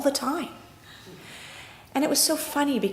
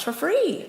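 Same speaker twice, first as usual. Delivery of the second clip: For free pitched much higher, with stress on free; emphatic repeat; 8 semitones higher than usual. Retold or imitated. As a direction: hit free high, the way the proud driver did. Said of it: "he said three times".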